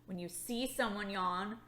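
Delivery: normal talking voice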